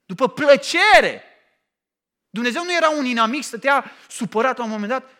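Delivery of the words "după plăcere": după plăcere drawn out slowly and louder; emphatic answer. Shown as a demonstration. Preacher stresses God's generous words to the congregation, slow and loud. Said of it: "după ce?".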